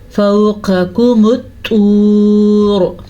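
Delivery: slow, drawn-out tajweed recitation; Quranic recitation. Shown as a demonstration. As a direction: recite slowly and melodically. Recites verse. (धीमी तिलावत)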